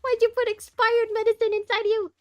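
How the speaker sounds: loud and high, laughing disbelief; exasperated yell. Real, not acted. Streamer scolding a game character, loud exasperated yell. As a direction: demand an answer in loud exasperation.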